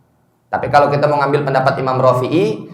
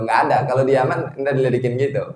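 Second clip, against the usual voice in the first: ordinary delivery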